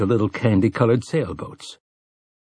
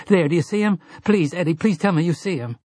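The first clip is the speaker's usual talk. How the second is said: much higher, desperate begging; frightened pleading; 8 semitones higher, faster than usual. Acted. Susanna begging Eddie to confirm the dead man, frightened. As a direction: beg him to see it too.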